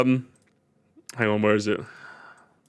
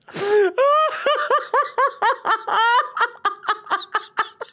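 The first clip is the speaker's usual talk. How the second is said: long high laugh. Real, not acted. Reacting to video.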